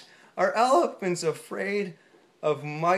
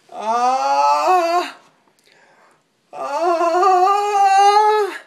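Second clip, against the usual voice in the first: rising sneeze buildup; 10 semitones higher, 9 dB louder than usual. Acted.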